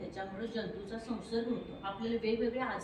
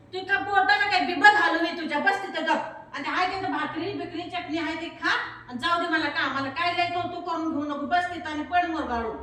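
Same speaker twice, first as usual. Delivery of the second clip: higher and much louder scolding; irritated mother yelling; 5 semitones higher, 8 dB louder than usual. Acted. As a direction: shout her down impatiently. Mother snapping at sick child.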